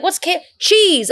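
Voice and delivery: high, slow, puzzled cheese question; playing the clueless customer; imitating a baffled customer; mock confused customer. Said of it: "They're like".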